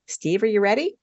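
plain reading voice

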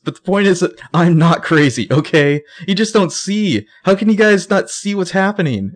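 high, urgent, near tears; desperate pleading. Retold or imitated. Spencer begs her friends to believe her, high desperate plea. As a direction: plead as if about to break. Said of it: "like, sobbing now".

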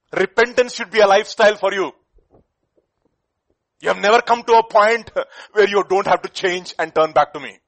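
loud, driving sermon voice; preaching at full force. Acted. Preacher urging the brother prophet to repent, loud. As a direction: drive the call to repent home.

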